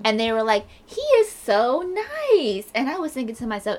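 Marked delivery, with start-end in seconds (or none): 0.8-2.7 high, slow, drawn-out gush; mock gushing. Retelling guests fooled by a nice front, gushing. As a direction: imitate admiring guests, stretching the words. Retold guests praising the man.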